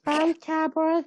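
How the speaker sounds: high, slow squeaky voice. Shown as a demonstration. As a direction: squeak every word out. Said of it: "What a squeaky voice".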